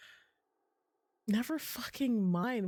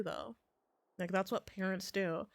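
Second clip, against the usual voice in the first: usual speaking voice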